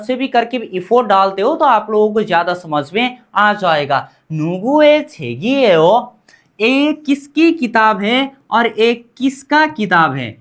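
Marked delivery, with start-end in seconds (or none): 4.3-6.1 slow model phrase. Shown as a demonstration. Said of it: "मेरे साथ उच्चारण आपको करना पड़ेगा".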